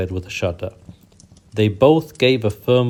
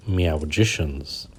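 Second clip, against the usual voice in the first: slow, low reveal of the word; dramatic reveal; 5 semitones lower, 3 dB quieter, slower than usual. A narrator's reading. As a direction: let the word land slowly. Reveal of the secret identity.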